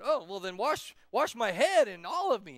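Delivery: loud, overexcited eagerness; acted over-the-top zeal; a little crazy. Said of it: "Peter got a little crazy".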